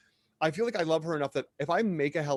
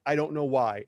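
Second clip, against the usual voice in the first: ordinary talk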